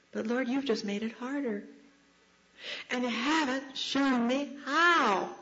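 plead, stretching the final word high; pleading complaint. Retold or imitated. Believer protesting to the Lord, pleading. Speaker complains to God in prayer.

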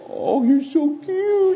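put-on very high, drawn-out gushing baby talk; exaggerated cooing. Girl to the puppy at her face, hammed-up cooing. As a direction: melt over the puppy, hammed up.

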